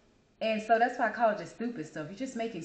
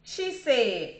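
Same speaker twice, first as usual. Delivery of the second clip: normal talking voice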